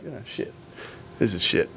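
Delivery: retold dejection. Turned-down job-seeker muttering to himself, dejected. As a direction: mutter it to yourself, deflated. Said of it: "I'm not feeling great about myself".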